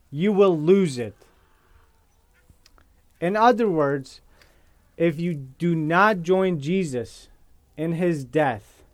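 slow, deliberate scripture reading with long pauses; spaced-out phrases, given time to land. Real, not acted. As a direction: read it slowly so nobody misses it. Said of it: "I'm gonna read it very slowly".

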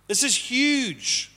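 "huge" stretched very slowly and held; slow, weighty emphasis on "huge"; emphatic stress. Real, not acted. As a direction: lean hard on "huge", dragging it out. Preacher stressing key point.

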